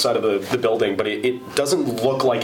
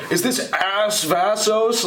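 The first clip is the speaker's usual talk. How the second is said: slow, higher imitation of an old man asking; old caller; 9 semitones higher, much slower than usual. Retelling the elderly caller asking for Ace, slow old man voice. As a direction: drag the words like a confused old man on the phone. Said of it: "So the dude was like".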